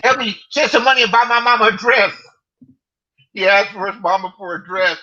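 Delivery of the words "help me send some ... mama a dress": high, loud put-on voice of the begging man; mocking imitation. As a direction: ham up his whiny plea. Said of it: "He said".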